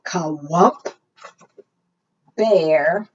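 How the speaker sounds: ordinary delivery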